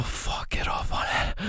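hushed urgent whisper. Acted. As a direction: egg her on under your breath. Whispered urging during sex scene.

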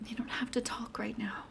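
soft, hushed whisper; gentle whisper. Acted. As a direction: hush her gently, no pressure. Calming withdrawn partner.

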